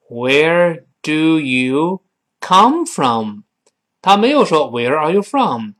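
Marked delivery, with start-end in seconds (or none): 0.1-3.4 each word drawn out slowly; very slow drill. Shown as a demonstration. Teacher drilling the class, very slow. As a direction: stretch the question out. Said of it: "slow model drill".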